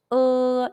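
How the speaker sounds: "uh" held long on a level pitch; hesitation vowel demo. Shown as a demonstration. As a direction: draw out "uh" like the English hesitation sound. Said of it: "used to express hesitation in English".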